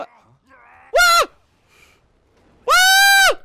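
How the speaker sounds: piercingly high, loud screams; panicked shriek. Real, not acted. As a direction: shriek at full volume. Scream in game.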